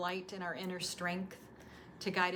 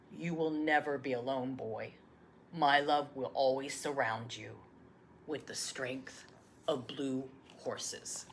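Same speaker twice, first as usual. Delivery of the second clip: tender grandfather; 6 semitones lower than usual. Acted. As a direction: comfort him gently, fading. Grandfather's tender last words.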